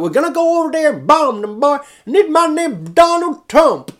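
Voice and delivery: high, shouted mock hillbilly; hillbilly accent. Imitating Trump supporters, loud hammed-up hillbilly accent. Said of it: "proper hillbilly kind of".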